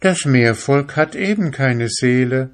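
mermaid's voice. Acted. Mermaid explains.

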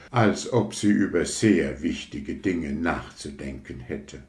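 plain reading voice

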